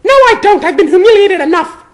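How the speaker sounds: put-on high, loud, wounded girl's voice; high loud girl voice, humiliated; upset Hermione. A narrator's reading. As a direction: shout back through the door, hurt.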